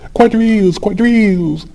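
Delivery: high, loud excited call; excited cry. Acted. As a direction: call out the dance with delight. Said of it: "excitedly clapping her hands and crying".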